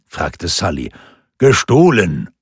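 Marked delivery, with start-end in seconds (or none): 1.4-2.3 GESTOHLEN hit hard and loud; emphatic correction. A narrator's reading. Lindqvist corrects Sully about his stolen arm, emphatic correction. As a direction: punch out "Gestohlen" as a louder correction. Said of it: "betonte Lindqvist".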